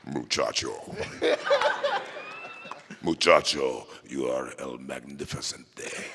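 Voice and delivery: very deep, soft stern-hero voice; character voice in Spanish. Pretend. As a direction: drop far down and rumble it low. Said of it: "your variation of boy in different languages".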